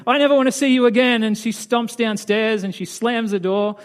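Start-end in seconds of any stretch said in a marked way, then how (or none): 0.1-1.2 acted angry outburst, high and loud; raised voice in a quick, indignant burst; mad. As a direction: fire it off fast, mock-furious. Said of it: "she got so mad".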